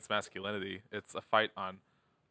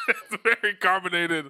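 high, slow, laughing it out; 11 semitones higher, 6 dB louder than usual. Real, not acted. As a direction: laugh through the words. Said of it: "I started to chug it".

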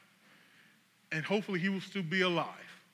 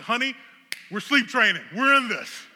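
higher, loud rallying call to a spouse; fired-up announcement; 5 semitones higher, 8 dB louder than usual. Retold or imitated. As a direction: reenact declaring a bold plan, loud and pitched up. Announcing sleep training to his wife.

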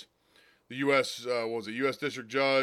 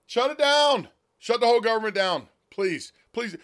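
loud, high, fed-up yell; angry shout; 8 semitones higher, 5 dB louder than usual. Real, not acted. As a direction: shout it at the politicians. Ranting at the government shutdown.

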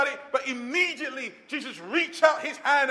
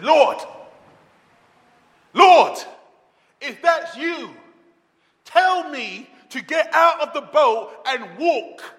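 loud pleading shout of Lord, Lord, slowing at the end; Peter crying out; 2 semitones higher, 8 dB louder, slower than usual. Retold or imitated. Peter begging Jesus from the boat, loud desperate cry. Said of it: "Then Peter cries out".

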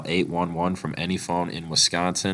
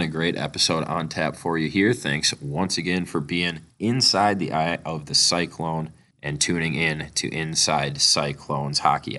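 ordinary delivery

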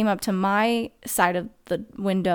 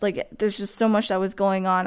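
ordinary talk

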